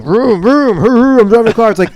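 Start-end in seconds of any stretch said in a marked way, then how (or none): 0.0-0.8 higher, silly, mocking "Vroom, vroom" like a kid playing cars. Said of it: "I don't like when people are like". 0.8-1.7 mocking voice. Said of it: "I don't like when people are like".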